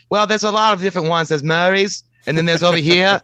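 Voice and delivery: high, loud mock Maine local; Maine accent. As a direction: give directions in a put-on Maine accent. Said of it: "that, that Maine accent".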